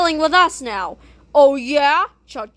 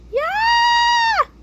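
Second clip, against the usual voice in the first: triumphant yell. Acted. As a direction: scream with joy. Characters cheer agreement.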